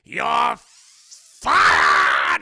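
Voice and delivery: loud, slow build to a shouted you're fired; boss firing him. Retold or imitated. Boss finally firing Goofy, building to a loud shout. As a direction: savor it, then blast it. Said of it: "He's like".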